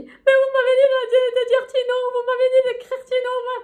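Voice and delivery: high, bright-eyed shop cashier. Retold or imitated. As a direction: play the chirpy cashier up high. Cashier voiced in retold story. (voix de caissière aiguë)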